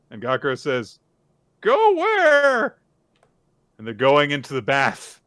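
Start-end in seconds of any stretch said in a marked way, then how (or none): 1.6-2.8 high, loud yelp; acted flustered squawk; startled question. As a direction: blurt it out in alarm. Startled suitor asks.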